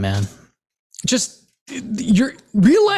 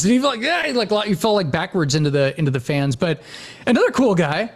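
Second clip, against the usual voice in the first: ordinary talk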